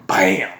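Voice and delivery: a sudden loud spoken impact noise; loud bam. Retold or imitated. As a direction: slam out the bam like the hit itself. Sound of jar hitting skull.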